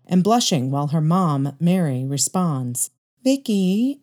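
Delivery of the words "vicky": mother's voice, pitched up, rising on the name; mother calling Vicky. Acted. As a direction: gently nudge her daughter by name. Mother prompts shy daughter.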